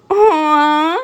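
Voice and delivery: high, drawn-out teasing aww; long drawn-out aww. A narrator's reading. Mina teasing the blushing Bakugo, long high aww. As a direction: stretch the aww high to tease. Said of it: "a long, drawn out".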